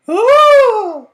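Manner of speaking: high loud swooning scream; loud swooning shriek; fainting cry. Acted. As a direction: wail as you faint. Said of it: "People fainted".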